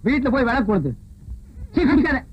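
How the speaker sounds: loud scolding order; loud, fed-up telling off; angry scolding. Real, not acted. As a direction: scold him loudly. Scolding the drunk.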